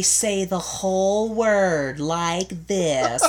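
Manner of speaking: mocking over-enunciation, slow and loud; [name].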